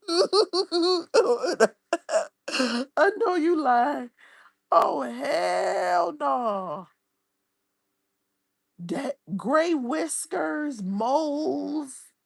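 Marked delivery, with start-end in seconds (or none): 0.1-6.9 much higher, louder, words through laughter; can barely get it out for laughing; laughing while talking. Real, not acted. Reacting to the photo, laughing through her words.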